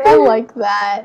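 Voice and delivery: ordinary delivery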